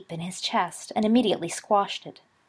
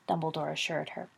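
ordinary delivery, nothing marked

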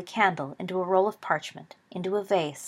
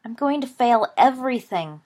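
a mild melodramatic wail, higher and louder; hammed-up despair over exams; 5 semitones higher, 5 dB louder than usual. A narrator's reading. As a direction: wail it out like it's the end of the world. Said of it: "Peter wailed".

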